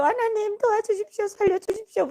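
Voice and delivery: high-pitched desperate retold plea; crying-out prayer. Prisoners wailing to God for rescue, desperate high plea. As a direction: beg God urgently, pitch way up. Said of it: "통성 기도를 했던 것 같아요".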